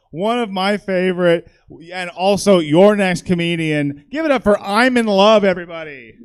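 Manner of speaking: loud, building announcer's call; emcee introduction. Real, not acted. Host introducing the next comedian, loud announcing. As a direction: project big to bring on the act.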